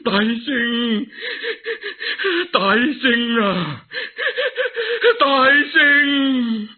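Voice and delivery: sobbing high voice calling; crying out. Acted. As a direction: wail the name through tears. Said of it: "喊咗三声".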